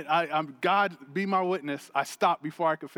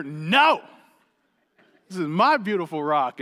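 mock snapped no; 4 dB louder than usual. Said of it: "in a very non-Christ-like manner".

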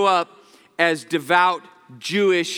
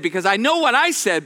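ordinary talk

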